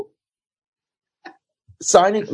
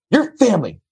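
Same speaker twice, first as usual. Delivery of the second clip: high, commanding mock producer; retold producer ordering him to sit; bossy producer voice; 9 semitones higher than usual.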